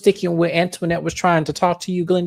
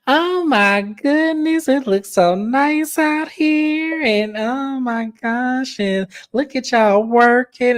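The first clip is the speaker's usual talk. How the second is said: high, slow, sugary-sweet mock voice; imitating Letitia gushing high and slow; 7 semitones higher, much slower than usual. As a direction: gush it out slowly like a syrupy fake-nice wife. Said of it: "she goes".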